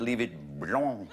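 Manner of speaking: blanc said the French way, slow and low; deadpan punchline. Retold or imitated. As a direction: leave it blanc in mock French. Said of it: "I said".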